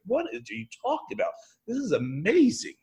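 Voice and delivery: high, bright-eyed amazement, pitched well up; delighted disbelief. Retold or imitated. Turning a traffic jam into a gift, high amazed. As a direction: scoff at the old problem, then marvel. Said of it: "sucked a second ago be like".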